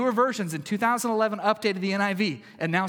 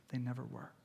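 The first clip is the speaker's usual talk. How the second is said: softer and hushed, almost whispered; 5 semitones lower, 13 dB quieter than usual. Real, not acted. Preacher reassuring the congregation, hushed. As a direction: say it low and hushed. Repeated reassurance, first take.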